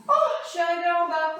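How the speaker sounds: loud, high, quick exclamation; playful arrival cry. Pretend. Teacher playing a skier who has reached the bottom, relieved exclamation. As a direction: burst out with mock relief.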